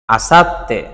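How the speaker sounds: আসাত্তে as asat-te, 't' held; doubled consonant. Shown as a demonstration. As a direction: pause on the 't' in আসাত্তে before 'te'. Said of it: "দুইবার উচ্চারিত হয়েছে".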